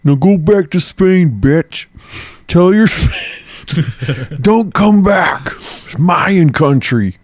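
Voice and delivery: high, drawn-out taunting yell; hammed-up triumphant taunt, pitched high; mock angry send-off. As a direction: yell them out of your country. Acting the king's angry send-off.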